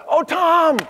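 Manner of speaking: high, fond mock sigh over Tom. Worker who loves the boss, a high fond sigh. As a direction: gush "Oh, Tom" up high with affection. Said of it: "if they love Tom, it would sound like".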